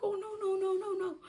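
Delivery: high, crying protest. Retold or imitated. Panicking as doctor yells, high crying noes. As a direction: wail the noes, pitched high.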